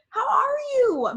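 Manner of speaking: "How are you" pitched very high and loud; "How are you" as an exaggerated high, loud question; eager question. Shown as a demonstration. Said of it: "So I asked you today".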